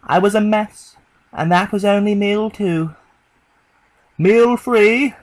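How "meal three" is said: a loud, announcing call; captor's loud call to the prisoner. A narrator's reading. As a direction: ring it out across the room like a host. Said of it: "The man's voice rang out".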